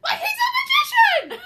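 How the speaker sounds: high, loud, incredulous laughing shout; Sarah can't believe it, laughing; laughing shocked shout. Retold or imitated. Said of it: "She's like".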